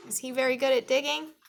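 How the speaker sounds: plain reading voice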